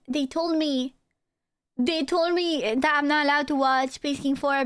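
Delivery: ordinary talk, nothing marked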